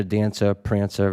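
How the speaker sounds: ordinary talk, nothing marked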